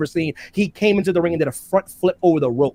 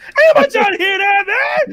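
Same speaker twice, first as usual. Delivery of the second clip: very high, loud comic squeal; exasperated outburst; 5 dB louder than usual. Acted. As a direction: shout it down in mock outrage. Exploding at a dull talker.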